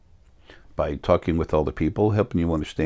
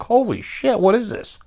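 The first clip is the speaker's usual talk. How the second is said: amazed exclamation. Retold or imitated. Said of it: "they're like".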